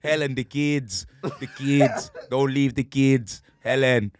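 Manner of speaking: a hint of Schwarzenegger pleading; mild Austrian action-hero imitation; Arnie movie quote. Quoting True Lies movie.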